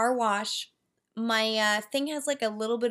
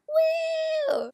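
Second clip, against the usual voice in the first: very high cheering woo; excited woo. Real, not acted. Hyped about tomorrow's fight scenes, a high whoop. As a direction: whoop it up with glee.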